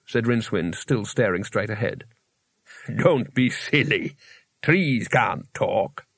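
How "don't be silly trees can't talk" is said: very slow, drawn-out creature voice; talking rock. Acted. Rock sets Rincewind straight about trees, very slow voice. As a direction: drag each word out, stonily matter-of-fact.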